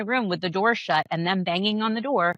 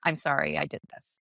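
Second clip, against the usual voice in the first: simple apology; 3 semitones lower, 6 dB quieter than usual. Retold or imitated. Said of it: "very simply".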